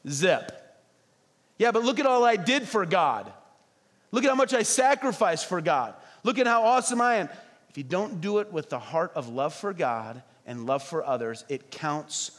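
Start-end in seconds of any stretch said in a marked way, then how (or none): 1.6-7.3 raised, smug bragging voice; mock a proud show-off, higher; hammed-up self-satisfied boast; boastful churchgoer. Mocking a self-praising believer.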